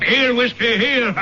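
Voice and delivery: high call bringing a dog back. Acted. Sir John calls Whiskey back to him, high call.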